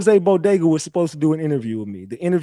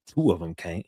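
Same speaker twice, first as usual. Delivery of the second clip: usual speaking voice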